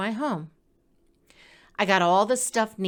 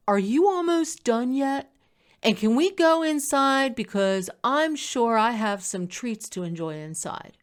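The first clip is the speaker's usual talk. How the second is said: cat's thoughts voiced; 4 semitones higher than usual. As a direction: voice the impatient cat, a bit higher. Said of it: "he's looking at me like".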